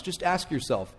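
ordinary talk

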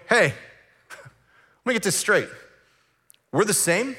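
high, indignant disbelief; offended. Retold or imitated. Day laborer confronting the master, high offended disbelief. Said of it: "They're offended".

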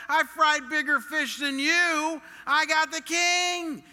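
loud, high shout with drawn-out words; full-volume indignant bragging, pitched high; knee-jerk boastful retort. Acted. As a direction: throw the king's backing in their faces, shouting slowly. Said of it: "we would have said".

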